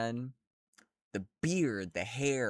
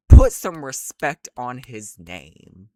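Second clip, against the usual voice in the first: very loud, slow command; loud demand; 16 dB louder, slower than usual. Real, not acted. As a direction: shout the demand out. Demanding respect for character.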